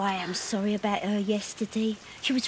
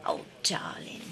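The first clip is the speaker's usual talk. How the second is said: low patronising whisper; 7 dB quieter than usual. Real, not acted. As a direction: breathe it out condescendingly. Patronising reply in row.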